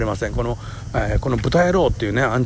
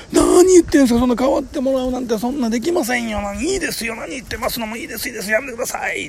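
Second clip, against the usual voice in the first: lightly voiced woman declining, higher and hammed-up; a hint of an overly polite lady saying no; polite refusal; 11 semitones higher than usual. Woman keeps refusing the seat. (席を断る女性を軽くまねた高めの声)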